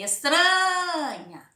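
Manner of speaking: estranha with TRA held long and loud; stress on TRA. Shown as a demonstration. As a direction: stretch and push the TRA of estranha. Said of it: "Foi a penúltima".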